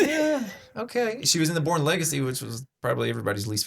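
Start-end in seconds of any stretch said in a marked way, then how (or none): 0.0-1.2 unimpressed. Retold or imitated. Mock unimpressed reaction to movie.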